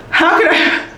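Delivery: can't keep a straight face; real laughter through the words; laughing. Real, not acted. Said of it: "I can't even say it with a straight".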